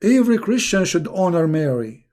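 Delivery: ordinary talk, nothing marked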